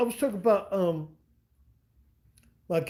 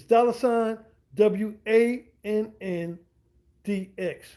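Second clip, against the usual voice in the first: each letter spoken slowly and separately; slow spelling out; much slower than usual. Shown as a demonstration. Spelling out his Cash App handle slowly for viewers. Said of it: "I'm spelling Swan with the dollar sign".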